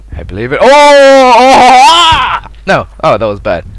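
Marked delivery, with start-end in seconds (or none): 0.6-2.5 long, loud, high scream; rising yelps into a drawn-out scream; alarmed scream. Real, not acted. Sudden danger in game.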